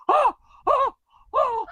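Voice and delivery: very high, loud oh oh oh; mock shocked gasps. Plane guy reacting to the punchline, high shocked squeals. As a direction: squeal like you just got the joke. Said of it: "the guy was like".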